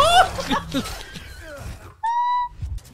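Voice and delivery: manic shrieking laugh. Real, not acted. Maniacal laugh after grenade.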